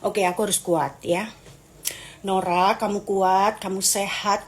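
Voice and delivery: slow, low affirmations to oneself; calm, slow mantra of self-support. Acted. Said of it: "begitu caraku untuk mensupport diriku".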